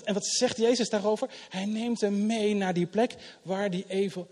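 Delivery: ordinary talk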